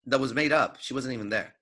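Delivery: normal talking voice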